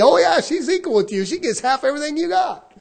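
high, loud mock judge; judge's ruling. Judge telling a divorcing husband the verdict, loud and high. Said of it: "The judge is gonna tell you".

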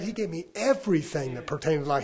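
normal talking voice

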